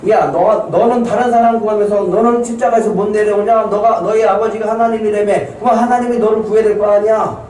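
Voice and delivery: high-pitched mock taunt; acted jeering. Bystander at the cross taunting Jesus, high-pitched jeering. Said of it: "그러면서 조롱했어요".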